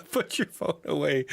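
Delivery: high-pitched, laughing through the words. Real, not acted. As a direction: crack up while pleading, voice high. Laughing at the influencer clip.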